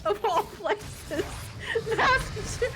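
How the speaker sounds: high, breaking laugh-cry; laughing to tears. Real, not acted. Friend baffled at being taken for Bostonian, laughing to tears. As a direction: squeeze the words out through tearful laughter. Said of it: "I'm crying again".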